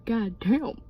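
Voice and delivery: ordinary talk, nothing marked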